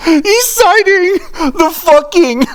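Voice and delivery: high, laughing "Dude, he's citing"; laughing disbelief. Real, not acted. Reacting to the reviewer citing the IGN article, laughing disbelief.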